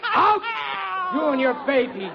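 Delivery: carried-away father banishing his daughter; yelling. Acted. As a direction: melodramatic shouted dismissal. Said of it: "Excuse me for yelling like I did".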